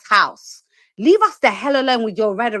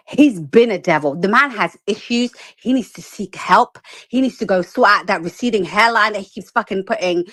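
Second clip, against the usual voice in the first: ordinary talk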